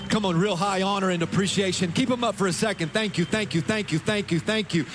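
high, loud thanks thrown out over clapping; rousing, repeated shouts of gratitude; calling over applause. Real, not acted. Urging applause over a clapping crowd.

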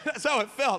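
usual speaking voice

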